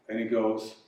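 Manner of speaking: ordinary delivery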